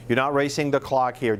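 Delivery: ordinary talk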